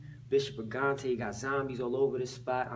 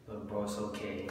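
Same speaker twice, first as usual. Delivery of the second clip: normal talking voice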